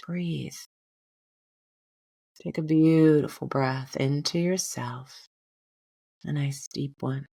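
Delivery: gently guiding a breath meditation, unhurried; guided meditation. Acted. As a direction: ease the listener into calm breathing. Leads a meditation.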